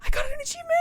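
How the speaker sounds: very high, loud squealed reaction; real high-pitched outburst; excited squeal. Real, not acted. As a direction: squeal it at full volume. Squealing reaction to game achievement.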